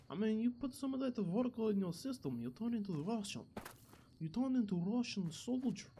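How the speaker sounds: put-on Russian accent. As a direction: play a boastful Russian soldier with a thick accent. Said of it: "been working on my Russian accent".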